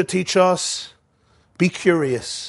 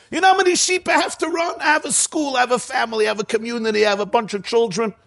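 high, fast, harried complaining; overwhelmed Moshe; 7 semitones higher, faster than usual. Retold or imitated. Moshe lists everything he must run, high and rushed. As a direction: rattle off your burdens in a raised voice. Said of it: "Moshe says this".

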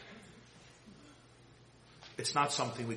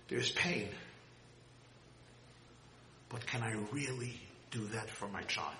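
ordinary talk

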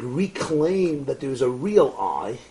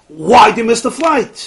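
demanding question; 7 semitones higher, 10 dB louder than usual. Retold or imitated. Organizer demands why he's missing.

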